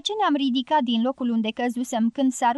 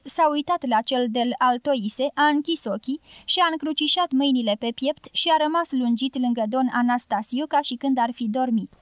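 plain reading voice

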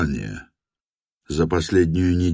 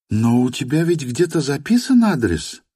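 lightly voiced woman, higher; young woman voice; 9 semitones higher than usual. A narrator's reading. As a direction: ask it in her higher voice. (женский голос, чуть выше)

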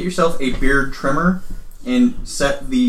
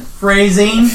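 loud phrasing callout; 7 semitones higher, 6 dB louder than usual. Acted.

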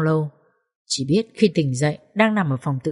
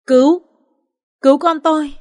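high, loud sobbing plea; sobbing cry for help; 8 semitones higher, 5 dB louder than usual. Acted. Woman clutching the officer, sobbing cry for help. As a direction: cry out clutching his arm. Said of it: "nấc lên".

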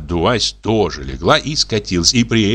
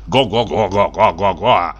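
geese honking. A narrator's reading. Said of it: "неодобрительно приветствовали его".

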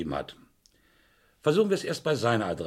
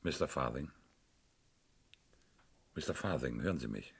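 softer and lower, gently calling a dying man; soft bedside call; 9 semitones lower, 8 dB quieter than usual. Acted. Inspector calling softly to the dying Farthing, hushed. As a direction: rouse him gently.

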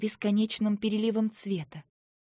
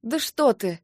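sharp indignant outburst, louder; angry retort; 4 semitones higher, 8 dB louder than usual. A narrator's reading. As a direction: snap back in anger. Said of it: "Я обернулась гневно". (гневно, с вызовом)

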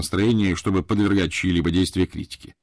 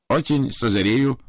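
lightly voiced polite Japanese butler; Japanese accent; 5 semitones higher than usual. (вежливый японский акцент)

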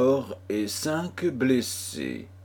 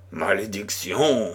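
plain reading voice

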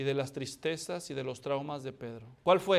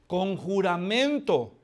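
usual speaking voice

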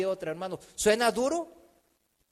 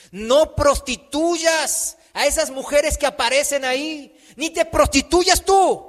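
loud, high, slow exhortation; raised preacher's voice, each phrase driven home; forceful preaching; 7 semitones higher, 10 dB louder, slower than usual. Real, not acted. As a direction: hammer the command out to the congregation.

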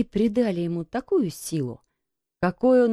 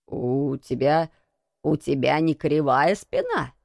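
put-on low, halting old man; mildly husky, lowered old voice; hoarse; 5 semitones lower than usual. A narrator's reading. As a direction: ask haltingly with a touch of hoarseness. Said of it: "хрипло спросил он".

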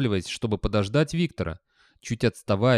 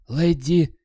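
higher, louder husky call; hoarse call; 6 semitones higher, 5 dB louder than usual. A narrator's reading. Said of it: "сипло позвал он".

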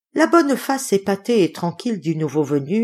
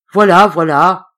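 loud, resonant, easy reply; booming yet gentle voice; soft gong-like voice; 10 dB louder than usual. A narrator's reading. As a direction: answer calmly from above. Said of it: "de sa voix douce comme un gong".

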